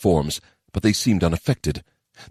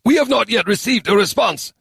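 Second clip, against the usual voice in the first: high crisp yes; smart reply; 3 dB louder than usual. A narrator's reading. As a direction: answer promptly and eager. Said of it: "the legate answered".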